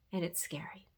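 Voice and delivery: hushed. Real, not acted. Speaker admitting to newcomers the invitation is scary, hushed. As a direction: drop to a confiding whisper.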